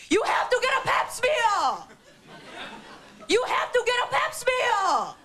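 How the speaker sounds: high, shrill-free mock yell with laughter; hammed-up mom nagging down the phone; mom screaming. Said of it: "She called me screaming yesterday".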